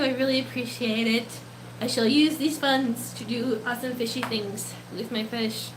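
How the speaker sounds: ordinary delivery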